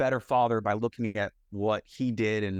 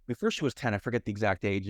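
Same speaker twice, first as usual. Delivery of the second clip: ordinary talk, nothing marked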